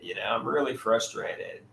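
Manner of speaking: the same complaint said again, deflated and quiet. Real, not acted.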